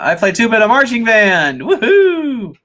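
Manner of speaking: high, loud cheering read-out; excited cheer. Acted. Streamer reading out a tuba player's comment, excited cheer. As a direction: whoop it up, pitched high.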